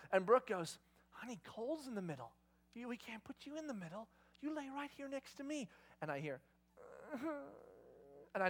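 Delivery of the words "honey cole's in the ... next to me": soft mother's coaxing, imitated; hushed gentle reasoning. Mom to daughter wanting the middle of bed, hushed reasoning. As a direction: reason with a child without waking the baby.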